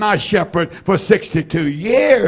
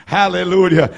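usual speaking voice

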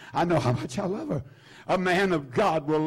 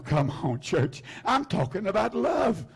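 ordinary talk, nothing marked

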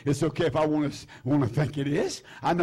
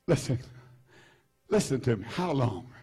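hushed appeal; 7 semitones lower, 5 dB quieter than usual. Real, not acted. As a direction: drop low and quiet to pull them in. Sudden hush before challenge.

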